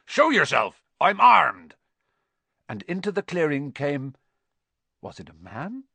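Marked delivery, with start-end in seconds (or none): 0.1-1.7 loud, raised challenge; calling out a warning. A narrator's reading. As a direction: warn off whoever is hiding, at a shout. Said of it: "Hagrid called".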